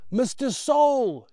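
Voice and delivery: loud, high, drawn-out slow call; shouting over noise. Acted. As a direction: stretch the name over engine clatter. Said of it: "shouts Brian above the clatter".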